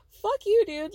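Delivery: high, loud burst of outrage; angry outburst. Real, not acted. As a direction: throw it out loudly, pitch leaping up. Outburst at his excuse, repeated.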